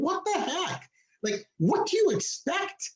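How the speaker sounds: high, slowed exasperation; patient exasperated at the nurse, high and slow; exasperated disbelief. Retold or imitated. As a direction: plead with disbelief. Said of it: "retold rant at nurse".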